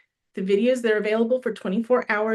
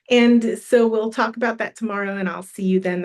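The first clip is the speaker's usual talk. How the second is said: usual speaking voice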